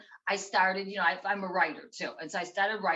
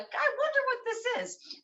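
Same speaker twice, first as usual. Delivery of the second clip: much higher, put-on voice of mom, innocently curious; mom's curious voice. Retelling mom at the meeting, high innocent voice. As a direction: play the innocent mother holding up the mystery string. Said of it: "she's like".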